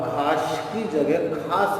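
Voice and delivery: first खास as khaash, second with clean s; wrong then right. Shown as a demonstration. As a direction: contrast खास ending sh with खास ending s. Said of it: "बोलना सीख गया". (पहला खास "श" से, दूसरा "स" से)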